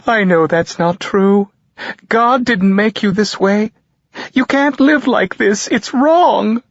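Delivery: a devout mother scolding through tears; mother condemning her son's life, high and tearful; crying mother. A narrator's reading. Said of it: "She's crying".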